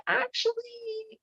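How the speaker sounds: high, drawn-out sly correction; mock know-it-all. Snake correcting Eve about the trees, mock know-it-all. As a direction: stretch it out high, teasing.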